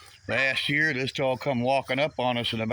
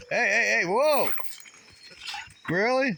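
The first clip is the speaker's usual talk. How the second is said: startled hey hey whoa; 8 semitones higher than usual. Real, not acted. Man to his jumping dog, startled whoa. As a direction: caught off guard by the dog, half laughing.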